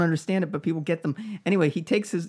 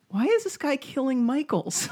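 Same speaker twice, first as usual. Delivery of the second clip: higher, puzzled voicing of the two guys; lightly voiced confusion; a hint of bewildered bystander; baffled onlooker; 8 semitones higher than usual. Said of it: "watching these two being like".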